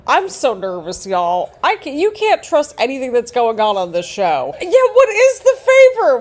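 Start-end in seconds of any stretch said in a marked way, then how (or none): 4.6-6.2 high, loud burst of worry; excited outburst. Real, not acted. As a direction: blurt it out, wound up. Anxious about the favor.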